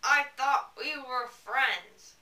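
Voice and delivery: slow seething through clenched teeth. Acted. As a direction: grind it out slowly, jaw tight. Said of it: "I spoke through my teeth".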